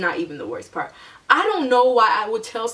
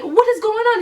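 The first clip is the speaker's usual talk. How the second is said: much higher, fed-up outburst; exasperated; 13 semitones higher, 4 dB louder than usual. Retold or imitated. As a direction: demand answers, totally fed up. Finally reaching the late driver.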